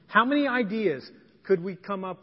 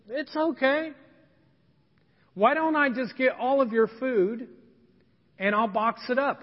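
reassuring Jen; 6 semitones higher, 4 dB louder than usual. Retold or imitated. Jen reassures the young mom.

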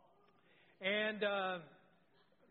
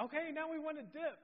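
much higher sing-song announcement; instructor's call; 9 semitones higher than usual. Retold or imitated. Scene: instructor announcing the dip to couples, much higher call. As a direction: call out the next move brightly. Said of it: "they said".

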